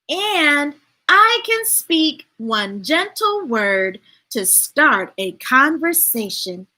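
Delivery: slow, measured storybook read; unhurried read-aloud; reading to children. Acted. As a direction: let each line land for the kids. Reads picture book aloud.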